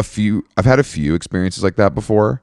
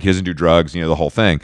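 ordinary talk

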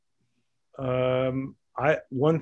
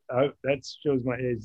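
ordinary delivery

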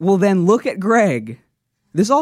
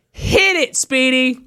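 high, called-out music cue; pitched-up announcer call; showman's cue; 5 semitones higher, slower than usual. Pretend. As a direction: call out grandly to start the music.

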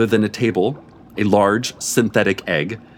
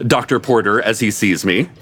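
ordinary delivery

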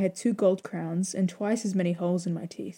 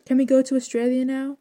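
higher, louder whiny plea; pleading little girl; 5 semitones higher, 5 dB louder than usual. A narrator's reading. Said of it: "she would ask me".

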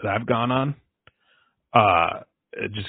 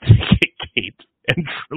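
ordinary talk

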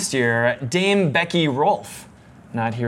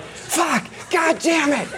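high-pitched strained whisper yell; hiss-yell the curse under your breath; 12 semitones higher, slower than usual. Retold or imitated. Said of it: "you were whisper screaming".

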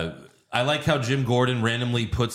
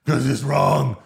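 mock patient Batman; 4 semitones higher, 6 dB louder, slower than usual. Batman answers the car.

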